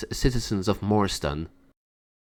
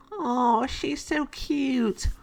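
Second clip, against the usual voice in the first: put-on much higher, slow cooing over a baby; cooing fairy; 3 dB quieter, much slower than usual. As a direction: melt over the newborn kitten, drawn out and high. Dory cooing at kitten.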